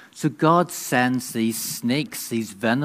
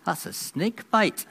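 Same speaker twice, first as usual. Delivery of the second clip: higher alarmed wife voice; Rebecca; 8 semitones higher, 3 dB quieter than usual. Acted. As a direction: say it pitched up, dismayed. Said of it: "says Rebecca".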